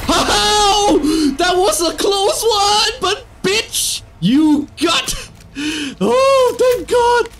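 high, wailing cry of relief; real near-miss hysterics, pitch way up; shaken relief. Real, not acted. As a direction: gasp it out after a close call. Narrow escape in game.

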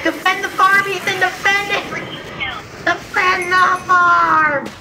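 rally your teammate to the farm; urgent shouting. Real, not acted. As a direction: gamer yelling at teammate to defend. Urgent game calls.

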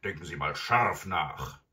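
loud, gruff villain taunt; mocking robber. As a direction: jeer loudly at the old lady. Robber mocks grandmother after laughing.

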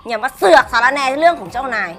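low, haughty sneer; cold contempt. Acted. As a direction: look down on her and scold coldly. Mistress scorns the maid.

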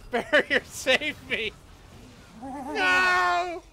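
high, loud panicked cry; desperate yell. Real, not acted. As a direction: plead loudly as you die. Dying in game.

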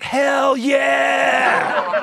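loud rock yell in church; shouted hell yeah. Retold or imitated. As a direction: belt it out like a rocker. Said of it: "I'd shout".

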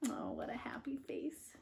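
soft whisper; whispered aside. Real, not acted. Reader whispering to young listeners. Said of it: "reader aside".

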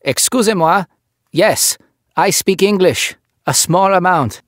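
put-on halting English with a heavy French accent; thick French accent. French officer replying in halting English, thick French accent. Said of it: "The accent was thick".

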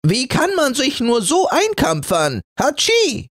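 high complaint ending in a sneeze; grumble, then sneeze; red flannel irritated by camphor; high indignant flannel. Acted. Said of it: "der rote Flanell".

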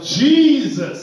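a high, loud cry of the name. Pretend. A fearful person crying out to the Lord, a high loud call. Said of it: "Whereas somebody may cry out".

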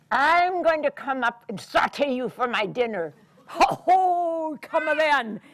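loud troll threat; troll threatens big goat. Acted. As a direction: boom it.

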